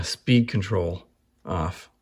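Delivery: ordinary delivery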